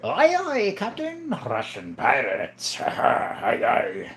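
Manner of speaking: hammed-up pirate with a rough laugh; Russian pirate. Livestreamer playing up a viewer's remark, Russian pirate. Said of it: "You sound like a Russian pirate".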